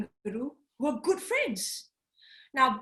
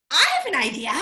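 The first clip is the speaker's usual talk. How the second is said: bright idea; 3 semitones higher, 7 dB louder, much faster than usual. Acted. Tree kangaroo with an idea.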